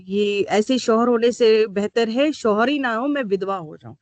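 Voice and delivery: ordinary talk, nothing marked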